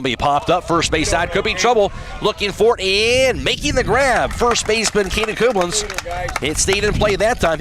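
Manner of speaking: rising play-by-play excitement; radio announcer calling a tricky pop-up; excited call. Real, not acted. Pop-up near first base.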